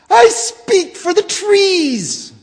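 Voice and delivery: very high, loud, slow insistent cry; Lorax crying out. Acted. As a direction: drag it out, insisting hard. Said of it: "cried the Lorax".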